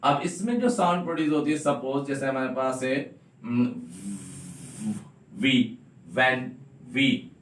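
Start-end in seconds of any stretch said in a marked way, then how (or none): 5.4-6.4 "when" said slowly with a clear v, lip on upper teeth; drawn-out "वी, when" showing the v; slow v sound demo. Shown as a demonstration. Teacher showing students the v sound, slowly drawn out. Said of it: "ये देखिए जबान".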